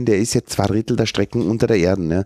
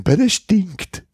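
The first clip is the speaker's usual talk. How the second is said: disgusted commuter; 10 semitones higher, much slower than usual. Retold or imitated. Commuter reacting to stinking train.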